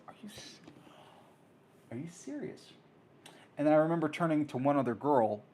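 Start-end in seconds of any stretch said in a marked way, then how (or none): 0.1-2.7 stunned whisper. Retold or imitated. Stunned aside.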